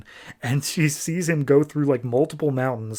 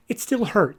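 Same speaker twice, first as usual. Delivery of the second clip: high, pretend-weepy voice; imitating a tearful confession; mock tearful hurt; 7 semitones higher than usual. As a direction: play it hurt, close to crying. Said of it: "starts breaking down crying".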